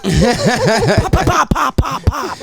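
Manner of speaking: high, loud pop pop gunshot sounds; imitated gunshots. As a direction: mimic the shots as quick pops. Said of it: "Describe what you heard".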